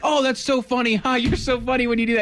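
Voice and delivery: high, bubbly mock praise; gushing fan voice. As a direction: gush like an amused fan. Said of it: "they're like".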